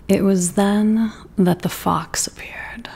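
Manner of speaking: breathy hushed read-aloud; whispered storytelling. A narrator's reading. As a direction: read the story like a secret at bedtime. Whispered story narration begins.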